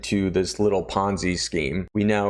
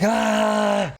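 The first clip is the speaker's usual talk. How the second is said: sudden high yell, much higher; high scream; 12 semitones higher than usual. Retold or imitated. Ulrich coming back up, screaming high. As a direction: scream like he's about to get shot. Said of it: "still screaming like".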